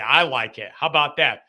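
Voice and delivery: usual speaking voice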